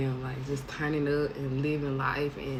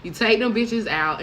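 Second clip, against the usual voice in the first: normal talking voice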